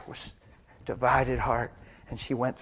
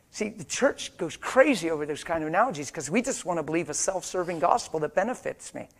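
ordinary talk, nothing marked

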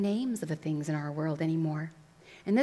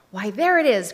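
loud, elated exclamation; rousing princely cry; cried out; 3 semitones higher, 10 dB louder than usual. A narrator's reading. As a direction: cry out in sudden recognition. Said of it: "cried the prince".

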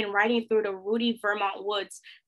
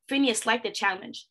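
ordinary delivery, nothing marked

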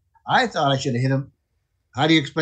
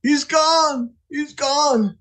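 alarmed cry; 10 semitones higher, much slower than usual. Retold or imitated. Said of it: "the guys are going".

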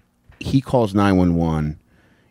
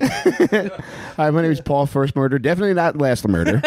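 high, whiny joke introduction; weepy radio caller; 7 semitones higher than usual. Pretend. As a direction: introduce yourself in a mock-tearful voice. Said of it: "identify him from, like, uh, calling in".

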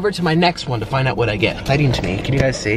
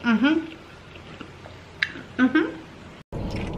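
mouth full; 5 semitones higher, 3 dB quieter, much slower than usual. Real, not acted. Eating, savoring.

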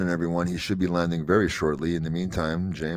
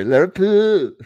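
Liverpool pitched higher and louder in a mock Scouse accent; a hammed-up Scouse Liverpool; 12 semitones higher, 10 dB louder, slower than usual. Co-host mocks the Scouse accent, high and loud. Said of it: "I cannot stand the accent at all".

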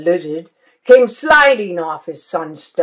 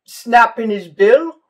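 usual speaking voice

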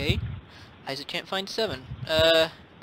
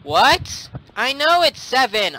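higher, indignant retort; fed-up, raised-pitch protest; exasperated; 5 semitones higher than usual. Real, not acted. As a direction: snap back in frustration. Exasperated after being shouted the number.

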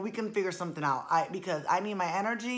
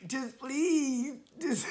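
laughing dread; 5 semitones higher, slower than usual. Real, not acted. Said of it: "she's gonna be a bridezilla".

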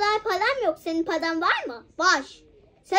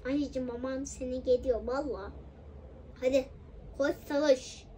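put-on soft, lower grumbling at the child; weary quiet scolding; 5 semitones lower, 9 dB quieter than usual. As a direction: scold under your breath, worn out. Scolding goes soft and low.

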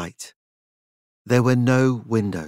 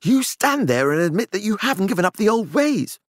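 loud; 9 semitones higher than usual. A narrator's reading. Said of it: "his voice shockingly loud".

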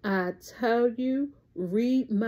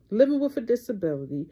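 normal talking voice

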